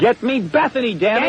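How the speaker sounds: high, impatient demand. Pretend. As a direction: snap the order out.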